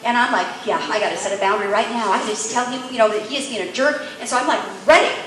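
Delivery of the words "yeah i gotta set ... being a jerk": ordinary talk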